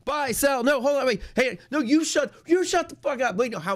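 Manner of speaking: high, loud panicked shouting over each other; frantic trader yelling. Retold or imitated. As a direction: mimic a movie trading floor in chaos. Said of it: "like, you hear on the movies, everyone's, like, crazy".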